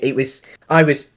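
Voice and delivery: usual speaking voice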